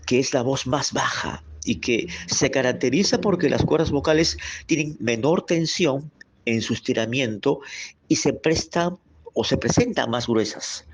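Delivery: ordinary talk, nothing marked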